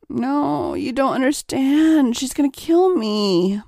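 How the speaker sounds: ordinary delivery, nothing marked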